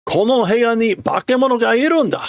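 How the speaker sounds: man panicking about a monster, high; character voice. Acted. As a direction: insist a monster is here. Voicing character.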